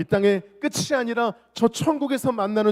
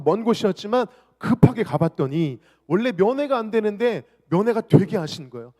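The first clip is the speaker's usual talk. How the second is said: ordinary talk, nothing marked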